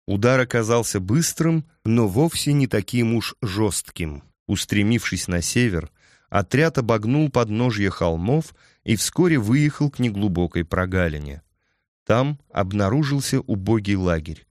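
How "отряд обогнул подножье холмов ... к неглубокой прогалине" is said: ordinary delivery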